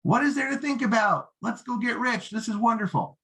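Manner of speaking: desperate salesman gushing; overeager rookie. Shown as a demonstration. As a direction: push the prospect with giddy enthusiasm. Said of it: "The rookie would say".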